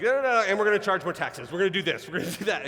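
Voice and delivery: rapid-fire political promises; really fast. Retold or imitated. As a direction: imitate a candidate racing through the bad news. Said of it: "that all goes really fast".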